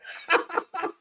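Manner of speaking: quick high burst of laughter; short high laugh. Real, not acted. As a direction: laugh breaking into the rant. Laughs mid-rant.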